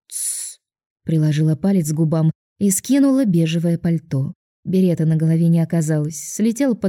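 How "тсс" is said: soft whispered shush. A narrator's reading. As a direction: hush them in a whisper. Shushing with finger to lips.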